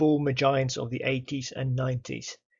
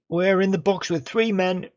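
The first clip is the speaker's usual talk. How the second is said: excited commentary; 5 semitones higher, 6 dB louder than usual. Real, not acted. Attack building in the box.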